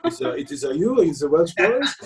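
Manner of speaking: put-on hesitant broken-English French accent. Hotel desk guy asking two guests, in a French accent. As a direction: put on a halting French accent, rising into the question. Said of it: "Oh, go on, [name]".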